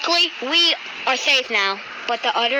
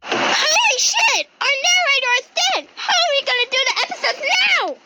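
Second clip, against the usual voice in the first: high, shocked yelling; shocked shout; 11 semitones higher than usual. Acted. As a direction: shout in disbelief. Narrator found dead.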